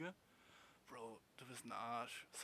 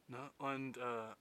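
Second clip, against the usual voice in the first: usual speaking voice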